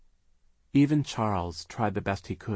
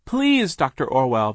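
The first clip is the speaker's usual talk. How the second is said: high, loud plea; crying out; 6 semitones higher, 10 dB louder than usual. A narrator's reading. Sister begs the hypnotist, crying out. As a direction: cry out to beg her. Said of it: "Violet cried".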